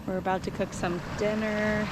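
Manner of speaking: normal talking voice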